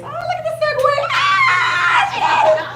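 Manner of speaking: shrieking high and loud with a laugh; delighted whoop. Real, not acted. Host cheering her own segue to the group with a high, laughing shriek.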